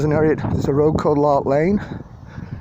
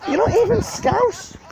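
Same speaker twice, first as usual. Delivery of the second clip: high, slowed heckling jeer; mock shout at the telly; slower than usual. Fans heckling [name] on the telly, high jeer. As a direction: jeer at the screen, laughing it off. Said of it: "We're, like, shouting at the telly".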